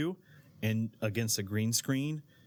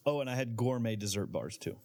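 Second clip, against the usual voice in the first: ordinary delivery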